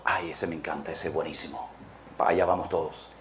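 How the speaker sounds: mock believer gushing under their breath; gossiping churchgoer. Churchgoer raves about Apolos.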